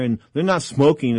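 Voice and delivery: ordinary talk, nothing marked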